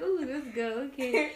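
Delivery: laughing, impressed ooh; giggly mock-impressed; amused reaction. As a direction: react like you just heard juicy gossip. Impressed reaction while listening.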